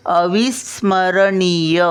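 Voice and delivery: अविस्मरणीय said slowly, half स, full म; careful syllable demo. Shown as a demonstration. Grandmother teaching children to say अविस्मरणीय, slowly and clearly. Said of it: "सचा अर्धा उच्चार".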